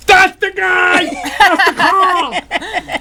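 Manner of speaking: high, loud, dragged-out shout; shouting accusation. Retold or imitated. Sanitation man yelling to the cop, high loud shout. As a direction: yell and point him out.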